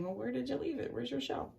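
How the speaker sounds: a hint of a cheeky fish; character voice. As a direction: tease him about his lost shell. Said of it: "this guy's saying".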